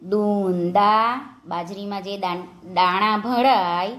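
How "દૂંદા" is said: દૂંદા drawn out, rising at the end; slow word demonstration. Shown as a demonstration. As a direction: stretch both syllables of દૂંદા for learners. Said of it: "આપણે શબ્દો જોઈએ".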